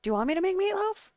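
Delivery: loud, high mock-mom yell from far off; mom yelling back. Mom shouting back that she's making lasagna, loud yell. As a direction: holler back through the house like an annoyed mother. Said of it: "I hear her yell".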